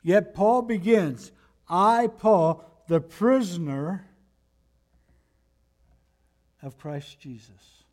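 fervent pulpit climax; preaching at full force. Acted. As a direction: drive the point to the congregation. Climactic sermon point.

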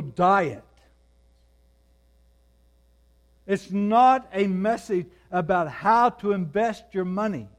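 loud, driving pulpit preaching; rising fervent sermon delivery; preaching at full force. Acted. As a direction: hammer each claim home to the congregation.

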